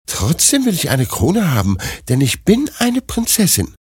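high, insistent princess voice; stubborn princess. Acted. Princess still demands a crown, high stubborn voice.

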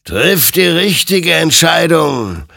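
loud, high, slow warning; solemn admonishing. Acted. Creature warns the armed Hector, slow and commanding. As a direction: admonish with drawn-out words. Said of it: "ermahnte ihn sein Gegenüber".

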